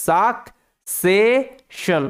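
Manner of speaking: slow, spelled-out সাকসেশন with a k then an sh sound; teacher modelling সাকসেশন as sak-se-shon; careful pronunciation. Shown as a demonstration. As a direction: say সাকসেশন slowly, first c as k, second as sh. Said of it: "একটা সি এর উচ্চারণটা কয়ের মতো আর একটা সি এর উচ্চারণটা শয়ের মতো".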